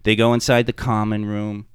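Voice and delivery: ordinary talk, nothing marked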